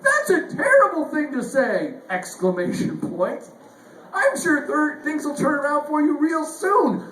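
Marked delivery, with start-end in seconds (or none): 0.0-1.9 chirpy bot exclamation. Retold or imitated. Chatbot answering a suicide message, higher and chirpy. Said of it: "And she goes again". 4.1-7.0 higher, perky double-exclamation cheer; cheery bot reassurance. Retold or imitated. Chatbot cheering up a user, higher and perky. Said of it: "And she goes again".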